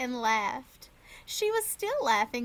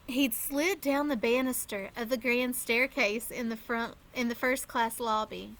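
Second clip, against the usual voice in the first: usual speaking voice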